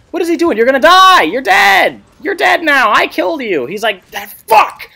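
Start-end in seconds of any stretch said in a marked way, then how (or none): 0.1-3.7 very high, loud yelling at an enemy; gleeful taunting shout. Real, not acted. Streamer to rival Wu while killing him, excited yell. 4.1-4.9 high, loud imitation of an enraged player; hammed-up outraged shouting; mock pissed-off yell. Streamer voicing the killed rival Wu, mock fury. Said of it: "he's pissed".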